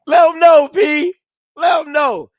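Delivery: high, full shout of encouragement; hyping him up. Real, not acted. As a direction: egg him on at the top of your voice. Co-host eggs Perry on.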